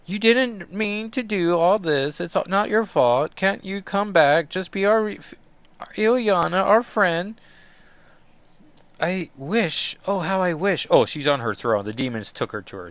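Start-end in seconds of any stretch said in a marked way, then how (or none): 0.1-7.4 put-on higher, begging girl; high, pleading Scottish friend. Rahne pleading. 9.0-10.9 wistful longing. Acted. Illyana voiced.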